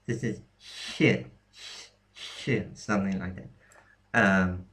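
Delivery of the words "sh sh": "sh" said softened, with a y-like glide; demonstrated soft sh. Shown as a demonstration. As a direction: say "sh" as a palatalized hushing sound. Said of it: "similar to that o-, other ch sound".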